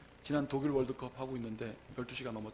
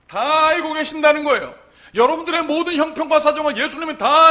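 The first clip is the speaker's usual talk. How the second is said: very loud, high-pitched proclamation; full-force preaching; 13 semitones higher, 17 dB louder than usual. Real, not acted. Preacher telling congregation Jesus knows it all, full-force preaching. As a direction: shout the truth to the congregation.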